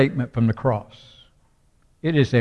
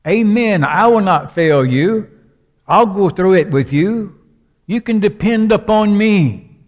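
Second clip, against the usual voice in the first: much higher and louder, ringing promise; fervent proclamation; 8 semitones higher, 7 dB louder than usual. Acted. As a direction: cry it out like a shepherd's vow. Preacher voices Jesus' assurance.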